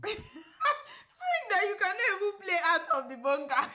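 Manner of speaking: high, loud, through laughter; real laughing mockery. Real, not acted. She mocks his boast of a beautiful swing, laughing.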